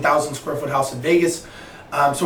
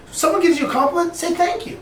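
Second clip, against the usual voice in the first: much higher-pitched insistence; retold admonishing; 12 semitones higher than usual. Mentor teaching mentee to accept compliments, insistently. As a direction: pitch it up as an obvious lesson.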